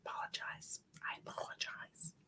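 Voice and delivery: soft breathy whisper; whispered apology. Real, not acted. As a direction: barely voice the apology. Apologizing for yawning.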